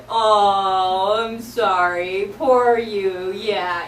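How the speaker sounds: loud, slow mock sympathy; mocking sorry-not-sorry; teasing pity. Shown as a demonstration. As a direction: drag out a sarcastic poor you. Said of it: "you could also do this teasing".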